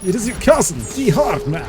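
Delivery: put-on high, loud Eastern European accent; hammed-up Eastern European cousin boast; Roman Bellic. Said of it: "my Eastern European accent".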